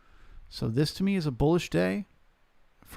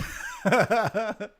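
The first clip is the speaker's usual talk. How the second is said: amused laugh; 7 semitones higher than usual. Real, not acted. Laughs after joke accent.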